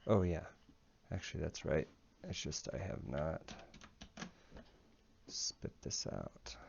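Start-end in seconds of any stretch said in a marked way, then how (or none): 2.2-6.6 weary, pained with a headache. Real, not acted. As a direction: push through a throbbing headache. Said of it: "my head is killing me right now".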